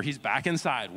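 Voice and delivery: ordinary talk, nothing marked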